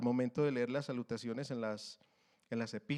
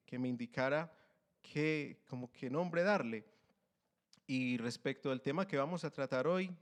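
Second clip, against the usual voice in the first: normal talking voice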